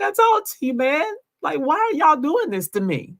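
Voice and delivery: imitating emotional plea. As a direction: voice his heartbroken why. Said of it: "you can hear the emotion in my husband's voice".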